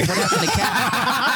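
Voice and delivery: high, drawn-out laughing question; mock worried question, laughing. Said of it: "texting me going".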